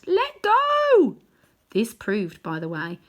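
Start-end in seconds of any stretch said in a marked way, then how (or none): 0.0-1.2 put-on high, loud girl's shout. A narrator's reading. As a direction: yell it as the frightened girl. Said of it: "Polly had been shouting out".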